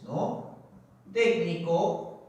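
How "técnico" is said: slow, stretched syllables, pauses between words; dictating slowly. Shown as a demonstration. Teacher sounding out the example for students, dictating slowly. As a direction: draw out pedagógico almost like a chant.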